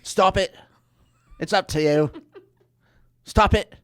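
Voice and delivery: loud, high Scottish brogue barking stop it; Scottish coach voice. Retold or imitated. Mimicking the coach's style for co-host, loud Scottish brogue. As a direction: bark it like a tough Scottish coach. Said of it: "That's his coaching style".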